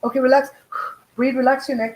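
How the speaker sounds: put-on a child directing mom at a photo shoot; daughter's voice. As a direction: mimic the daughter giving orders. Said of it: "So she was like".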